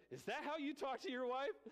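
high, half-hearted imitation of a scolding friend; mock scolding Kim. Pastor imitating Kim scolding him about his wife, high and teasing. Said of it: "And Kim goes".

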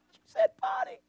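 laughing high squeal on party; real laughter at the accent. Real, not acted. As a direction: crack up at the word party. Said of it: "Say it again".

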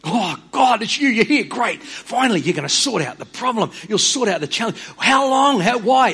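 high, loud eager questions rising to a shout; a prophet bursting with impatient hope. Retold or imitated. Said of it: "he speaks very clearly".

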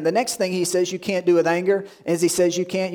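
usual speaking voice

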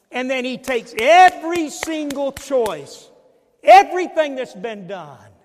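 loud, high, fervent preacher's cry; preaching at full force. Acted. Pastor telling the church of God's embrace, fervent.

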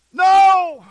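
loud, high burst of refusal; one big, booming no; emphatic shouted no. Acted. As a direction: shout the objection down. Rebuts an imagined complaint.

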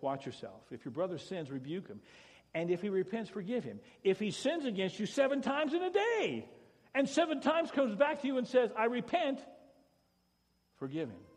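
thundering Jesus's command to forgive; preaching at full force. Acted. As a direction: proclaim the words of Jesus.

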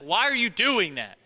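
yelling at the TV. Retold or imitated. Preacher yelling at a TV show. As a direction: yell at a character on screen. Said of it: "yell at T V shows".

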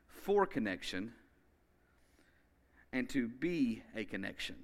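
slow, spaced-out declaration; slow emphatic repeat. Shown as a demonstration. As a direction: let each phrase land slowly. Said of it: "We'll say it one more time".

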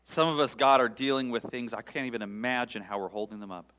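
loud, rising lament read to God; fervent psalm prayer. Acted. Preacher praying the psalm aloud before his congregation, pleading.

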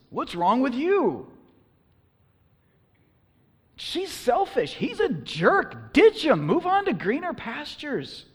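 high, loud exasperated advice-giver; loud, high-pitched urgent lecturing; indignant worldly advice. Acted. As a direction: scold them as the voice of the culture. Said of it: "the common advice we get in the culture around us".

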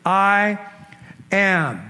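I ... am, loud and high with a pause; Jesus answering the soldiers, booming; booming declaration. Acted. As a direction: proclaim each word with a beat between. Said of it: "He said".

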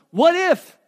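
sudden high, loud "What if?" in mock panic; Moses' fearful reply. Moses answering God from the bush, mock alarmed. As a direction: blurt it like a scared man. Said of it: "this is how he responds".

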